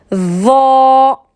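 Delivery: "va" as a heavy voiced TH with a long O-like A; tongue between teeth, thick drawn-out "va". Shown as a demonstration. Teacher demonstrates the letter, heavy interdental "va". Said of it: "mettez bien la langue entre les dents".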